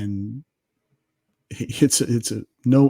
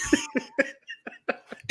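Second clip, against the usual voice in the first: a burst of high, airy laughter; high wheezing laugh at his own story; high breathy laugh; 7 dB quieter than usual. Real, not acted. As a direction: let the laugh fly up high.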